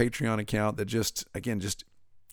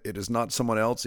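ordinary talk, nothing marked

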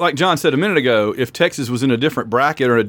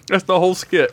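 usual speaking voice

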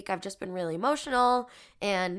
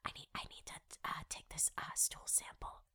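hushed, breathy whisper of shame; embarrassed whisper; 4 semitones lower, 18 dB quieter, faster than usual. Retold or imitated. To the man at the desk, an embarrassed whisper. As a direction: whisper it so nobody else hears. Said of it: "I actually whispered".

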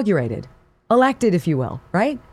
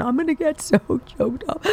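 mock choked-up; 9 semitones higher than usual. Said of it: "Rashida Tlaib using her emotion".

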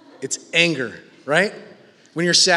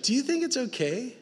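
soft, tender asking; gentle question; 6 semitones higher, 9 dB quieter than usual. Acted. A father softly asking his son, tender. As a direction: quietly invite him to think.

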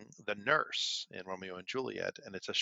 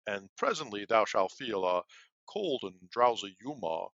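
apothecary character voice; 3 dB louder, slower than usual. Retold or imitated. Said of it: "doing the apothecary in a different voice".

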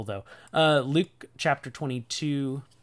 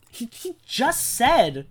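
high, slow, spluttering disbelief; drawn-out, high-pitched bafflement; incredulous; 10 semitones higher, 5 dB louder, slower than usual. Real, not acted. Incredulous at the disciples' answer.

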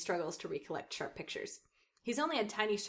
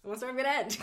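ordinary talk, nothing marked

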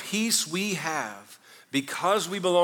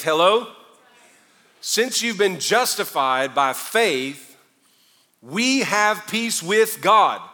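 loud, high, impassioned sermon climb; full force preaching; 8 semitones higher, 9 dB louder than usual. Acted. Pastor to congregation on peace with God, impassioned preaching. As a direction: drive it home to the back row.

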